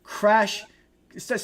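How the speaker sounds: ordinary talk, nothing marked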